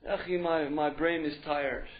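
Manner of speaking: slow, low, weary excuse; sluggish youth. Acted. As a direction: drag it out like a drained, lazy youth. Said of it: "It's always sluggish, sluggish, sluggish".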